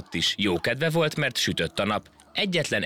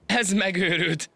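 laughing; 12 semitones higher, slower than usual. A narrator's reading. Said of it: "teli torokból nevetni kezdett".

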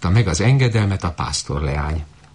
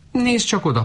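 high squawking goose; lightly voiced high goose. A narrator's reading. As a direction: squawk to point it out. Noisy goose points.

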